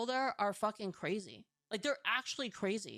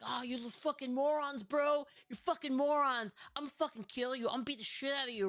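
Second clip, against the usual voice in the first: retold yelling; 4 semitones higher than usual. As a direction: imitate a street lunatic screaming insults. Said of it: "this freaking psycho just starts yelling".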